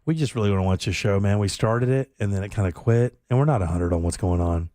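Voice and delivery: low, laid-back, drowsy ask; very mellow. Retold or imitated. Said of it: "it was just very mellow".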